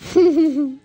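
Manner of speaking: low chuckle from the auntie; short laugh. Acted. Auntie laughing at the sleepy niece, low chuckle.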